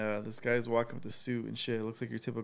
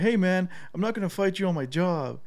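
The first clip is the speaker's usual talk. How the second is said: nervous tour guide; 6 semitones higher, 5 dB louder than usual. Retold or imitated. Tour guide refusing to fight.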